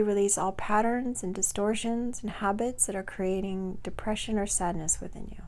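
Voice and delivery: soft, unhurried meditation voice with long pauses; guided meditation. Acted. Coach guiding listeners to release depression, soft guided-meditation voice.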